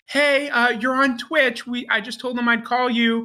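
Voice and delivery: high, hesitant retold phone greeting; intimidated caller. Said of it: "she's, like, intimidated".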